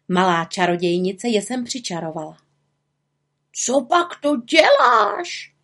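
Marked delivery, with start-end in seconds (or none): 3.5-5.5 high, slow, stretched squawk; aghast raven, drawn out high; croaking raven. A narrator's reading. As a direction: squawk the question in alarm. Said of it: "krákal Abraxas".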